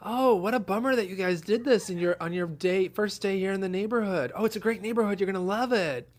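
mock cheery neighbor. Cheerful neighbor retold, higher.

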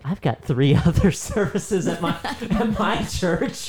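laughing through the words, pitch rising high. Retold or imitated. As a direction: laugh while protesting. Retelling a laughing protest to wife.

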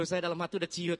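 ordinary talk

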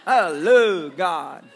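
slow "I love God" with "love" as "lu"; slangy drawl; slang drawl. Shown as a demonstration. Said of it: "It's love".

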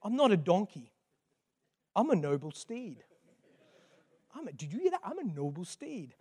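lightly voiced donkey, hushed self-discovery; softly marvel, as the penny drops; donkey's dawning realisation. Donkey realising he is a noble steed, hushed amazement. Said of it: "penny had dropped in him".

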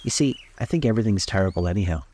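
ordinary delivery, nothing marked

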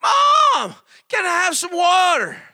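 put-on high, loud child's wail for water; child hollering. Little son hollering from bed to mom for water. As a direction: holler up the stairs like a wired little boy. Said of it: "'cause he would holler out".

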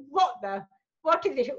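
imitated Indian-accented mum, outraged; mock-appalled mum with Indian accent; mum's Indian accent. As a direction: scold in a put-on Indian accent. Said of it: "I keep putting this Indian accent on".

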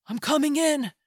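loud urgent shout through the door. A narrator's reading. Narrator shouting into the house, loud and urgent. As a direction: call out loud into the dark house. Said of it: "you shout".

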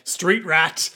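loud mock guard's yell; hammed-up angry order; menacing guard. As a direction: bark it like a bullying guard. Guards menace Isaac, acted out.

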